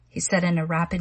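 usual speaking voice

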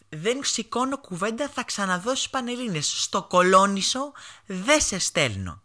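high-pitched, slow scolding mother; mock angry yelling. Mother yelling at her drunk son in the car, high mock-angry voice. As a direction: imitate a mother who will hear no argument. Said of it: "να μου φωνάζει".

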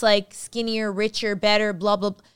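usual speaking voice

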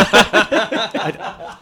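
laughing. Real, not acted. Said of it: "That's the worst Mourinho accent ever".

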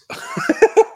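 high silent wheeze of a laugh; breathless squeaky laugh; wheezing laugh. Real, not acted. Wheezing laugh at being right.

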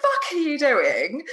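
high, incredulous mock protest; mind's alarmed voice. Swimmer's mind balking at the cold river, laughing alarm. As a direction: voice the panicking mind, half laughing. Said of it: "my mind is saying".

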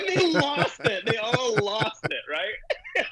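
high and slow, laughter in the voice; laughing while talking. Real, not acted. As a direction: still giggling as you say it. Laughing recalling audience reaction.

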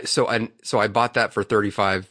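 ordinary delivery

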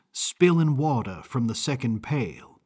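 plain reading voice

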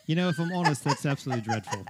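playful British understatement; fake British accent. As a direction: deliver a polite put-down in put-on British. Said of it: "with a fake British accent".

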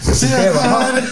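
high, laughing movie line; laughing quote. Retold or imitated. Laughing as he echoes the movie line, high and squealed.

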